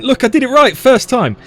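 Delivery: high, loud read-out of a shouted message; loud imitation of an excited shouter; mock shouting. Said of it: "Yanis shouting".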